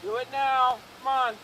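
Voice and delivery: booming PA order to come out; loudspeaker announcement. Real, not acted. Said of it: "If you can announce again".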